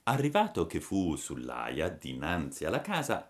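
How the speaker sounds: ordinary delivery, nothing marked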